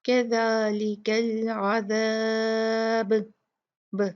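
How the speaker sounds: slow chanted कज़ालिकल अज़ाब, ब bouncing; qalqala at the stop. Shown as a demonstration. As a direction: end on a bouncing ब. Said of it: "इसको हिला के पढ़ेंगे". (आख़िरी ब को हिलाकर पढ़ें)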